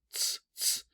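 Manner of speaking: ts, ts hissed without voice; a crisp unvoiced ts; ts sound. Shown as a demonstration. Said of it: "This means T S".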